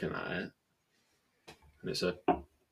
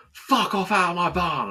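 higher, louder mock yell; angry seller; 10 semitones higher, 6 dB louder than usual. Seller ordering the buyer out of his barn, mock yell. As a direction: throw him out with a mock roar. Seller voiced in a retold row.

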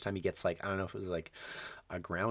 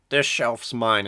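louder, firm claim; mock grumpy old man, louder; possessive declaration; 9 dB louder, slower than usual. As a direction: stake the claim loudly. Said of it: "he's just like".

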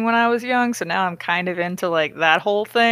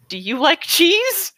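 higher, slow, mock-suave question; mock smooth deflection; 9 semitones higher, 3 dB louder, slower than usual. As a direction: tease it like a cheesy seducer.

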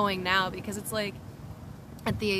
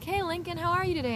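higher, sing-song cheerful hello; cheery greeting; 8 semitones higher than usual. Retold or imitated. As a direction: greet him brightly.

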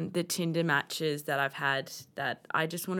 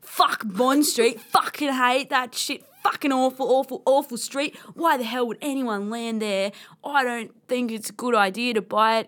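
hammed-up Aussie rant, punchy and raised; Aussie accent; 3 semitones higher, 4 dB louder than usual. Slagging off Bond Street in a chat, Aussie accent. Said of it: "I reckon we should do this in Aussie".

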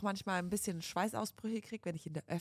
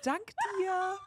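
put-on sing-out little child's thank-you; imitated toddler daughter; 11 semitones higher, much slower than usual. Mother imitating her daughter's thanks, toddler voice. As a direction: put on a small child's voice, rising higher. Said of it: "Die Alea sagt auch mal".

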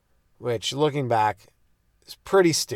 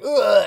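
disgusted ugh; 8 semitones higher, 6 dB louder than usual. Retold or imitated. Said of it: "he was like".

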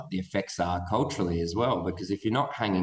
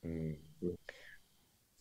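barely audible, half whispered; very quiet; 3 semitones lower, 20 dB quieter than usual. Real, not acted. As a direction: speak softly, almost inaudible. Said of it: "[name], you're super quiet".

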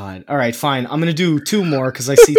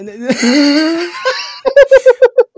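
high, loud, helpless burst of laughter; 12 semitones higher, 5 dB louder than usual. Real, not acted. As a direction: crack up completely. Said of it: "It makes me laugh".

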